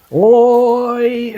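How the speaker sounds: sudden high Aussie "Oi!"; mock Aussie shout. Jokingly shouting to the room in a mock Aussie call. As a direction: bark it like a cartoon Australian. Australian shout after joke.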